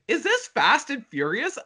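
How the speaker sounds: high-pitched baffled question; incredulous confusion. Retold or imitated. Viewer watching a baffling franchise trailer, incredulous. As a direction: ask it high, in mock disbelief.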